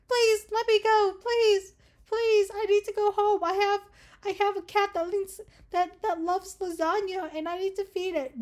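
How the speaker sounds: high, hammed-up desperate pleading; mock begging. Captive begging the kidnapper for release, mock begging. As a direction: beg up high for your pretend life. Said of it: "you're just begging to the fucking dude".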